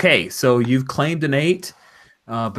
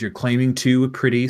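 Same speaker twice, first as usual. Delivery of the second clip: ordinary talk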